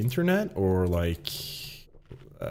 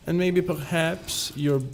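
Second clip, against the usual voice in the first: higher-pitched, shaky attempt at a German accent; Albert, German accent; 6 semitones higher than usual. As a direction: put on a high German accent. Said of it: "I can't do a German accent".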